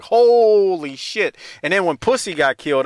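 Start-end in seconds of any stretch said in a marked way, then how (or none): 0.0-1.4 drawn-out stunned holy shit; slow, loud, stunned gasp of disbelief; shocked exclamation. Retold or imitated. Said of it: "I was like".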